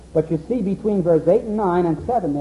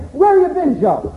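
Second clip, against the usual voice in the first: very high, loud curious ask; eager question; 10 semitones higher, 7 dB louder than usual. Retold or imitated. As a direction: lift it up, eager to hear. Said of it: "And you say".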